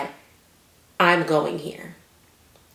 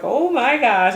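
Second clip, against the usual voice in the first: high, louder panicked cry; terrified gasp; 8 semitones higher, 7 dB louder, slower than usual. Retold or imitated. Dropped at the wrong spot, terrified. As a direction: blurt it out scared. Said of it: "I was terrified".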